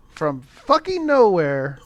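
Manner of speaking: ordinary delivery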